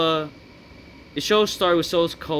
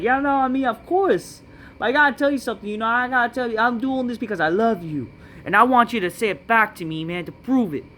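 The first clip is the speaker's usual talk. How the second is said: high, sappy mock pleading; mocking Solo Sikoa; 6 semitones higher than usual. Vlogger parodying Solo's promo, high mock pleading. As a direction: whine it up high like a needy brother. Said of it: "Solo Sikoa says".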